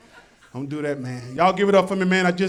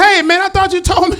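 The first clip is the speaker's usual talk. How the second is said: higher, louder mock outrage; indignant complaint; 6 semitones higher, 5 dB louder than usual. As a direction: confront the seller indignantly. Acted complaint to the seller.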